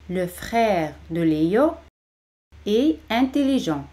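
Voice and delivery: "Le frère ... de Leo", pause, "est ... intelligent". Shown as a demonstration. Slow model before quick take.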